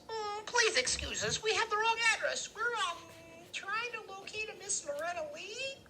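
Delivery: very high, put-on little voice; Freak's chattery high voice. A narrator's reading. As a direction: squeak out a polite excuse, very high. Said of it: "his voice is chattery high".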